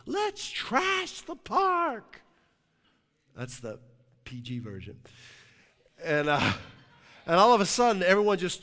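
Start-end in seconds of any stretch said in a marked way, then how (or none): 0.1-2.2 high, drawn-out rallying yell; retold scream. One of the guys to his smashed friends in the park, screamed. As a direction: imitate a rowdy guy screaming, every word stretched. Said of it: "one of the guys screams".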